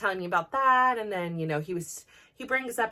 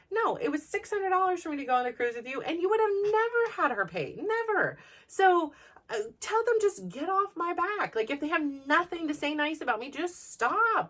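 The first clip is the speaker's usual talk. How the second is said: heated retold retort; 7 semitones higher than usual. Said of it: "I get so infuriated".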